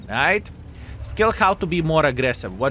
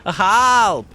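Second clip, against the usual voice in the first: loud, high panicked cry of Help; mock screaming for help; 8 semitones higher, 8 dB louder than usual. Enemy light tank panicking, loudly screaming for help. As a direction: yell for help like a cornered tank. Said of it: "He's screaming".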